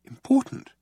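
very high alarmed question. A narrator's reading. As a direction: blurt it out startled. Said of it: "Arthur alarmed".

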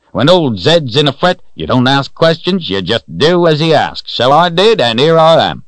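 put-on high, loud, hearty old-timer voice; jolly old man. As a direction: play a jovial old fellow reassuring startled travellers. Voices jolly Old John explaining.

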